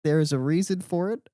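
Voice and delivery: loud, high retold protest; prideful rebuttal. Retelling defending the podcast name, prideful. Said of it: "I was more, like, prideful".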